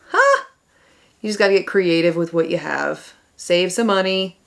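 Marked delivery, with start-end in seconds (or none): 0.1-0.4 very high, loud show-off huh; cheeky high huh; delighted huh. Real, not acted. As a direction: pop it up like a proud reveal. Showing off contour.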